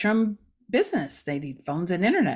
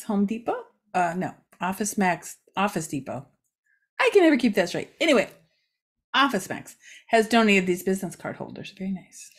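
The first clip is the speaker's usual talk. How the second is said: ordinary talk, nothing marked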